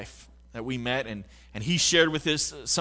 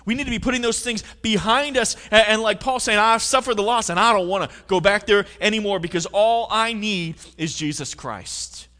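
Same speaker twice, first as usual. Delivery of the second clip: 1 rising preaching intensity; 5 semitones higher, 5 dB louder than usual. Real, not acted. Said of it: "climax of sermon".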